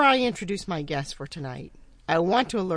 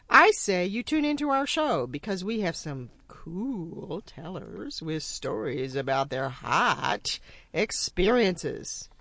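usual speaking voice

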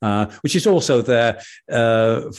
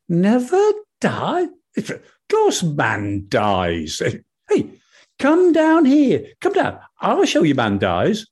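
much higher, squeaky little rabbit voice; a small eager animal calling up to the sky; high chirpy rabbit, stammering; excited rabbit; 10 semitones higher, slower than usual. Acted. Rabbit scoffs and urges moon down.

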